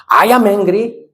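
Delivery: high-pitched acted complaint; mock anger. Shown as a demonstration. Teacher leading everybody in the line, mock angry. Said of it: "Everybody together".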